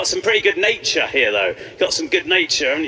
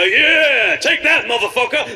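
jellyfish attack cry; 5 semitones higher, 3 dB louder, slower than usual. Pretend. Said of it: "he'd jump out and go".

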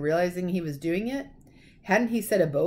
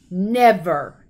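much louder booming word; thundered; 9 dB louder than usual. A narrator's reading. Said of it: "he thundered".

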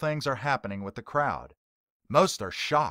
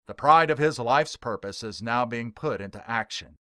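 plain reading voice

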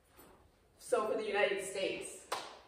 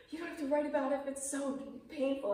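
gentle friend; 9 semitones higher than usual. Retold or imitated. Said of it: "she goes".

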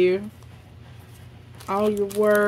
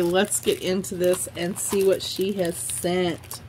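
normal talking voice

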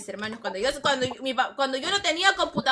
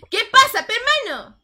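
loud exclamation; 11 semitones higher, 11 dB louder than usual. Real, not acted. Vlogger shouting in disbelief to her viewers. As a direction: exclaim it loudly, higher.

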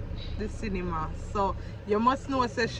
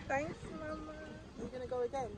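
ordinary talk, nothing marked

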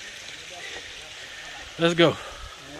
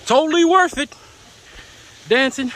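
excited; 10 semitones higher, 4 dB louder than usual. Real, not acted. Thrilled after dancing.

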